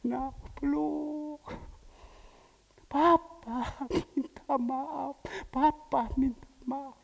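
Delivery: gentle, very slow fatherly forgiveness; tender father. Retold or imitated.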